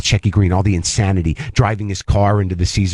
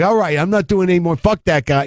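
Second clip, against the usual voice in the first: higher mock angry brush-off; hammed-up annoyed old man, pitched up; imitating a gruff dismissal; 9 semitones higher than usual. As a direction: wave him off like a cranky old comic. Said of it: "Like, basically like that".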